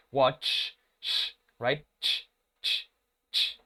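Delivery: "watch" ending on a hissed ch, then ch, ch, ch voiceless; ch sound demo. Shown as a demonstration. Teacher isolating the ch sound for the class. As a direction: hiss out "ch" on its own. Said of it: "Ese sonido, que se representa con estos fonemas".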